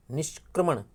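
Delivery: usual speaking voice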